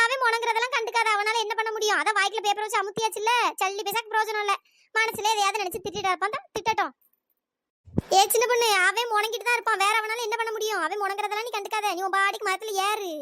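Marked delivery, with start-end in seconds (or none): none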